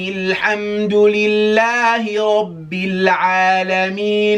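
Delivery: melodic chant without pausing for air; all in one breath. Shown as a demonstration. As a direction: recite it straight through on one breath. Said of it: "see if I can do the whole thing ... without taking a breath".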